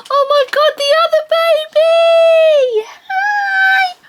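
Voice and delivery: very high loud squealed greeting. Real, not acted. Spotting the other dog, squealing baby talk. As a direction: shriek with delight at the puppy.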